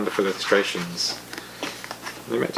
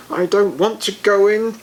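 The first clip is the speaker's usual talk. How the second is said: much higher, louder whiny refusal; angry little dragon; 10 semitones higher, 4 dB louder than usual. A narrator's reading. Fergal refusing his teammates on the pitch, angrily. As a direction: refuse with a childish stamp.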